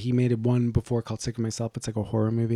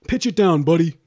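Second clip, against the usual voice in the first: bossy brother; 6 semitones higher, 8 dB louder than usual. Retold or imitated. Acts brother ordering a lower voice.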